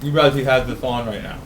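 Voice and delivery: talking through a mouthful; words round a bite of food; mouth full. Real, not acted. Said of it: "talking with my mouth full".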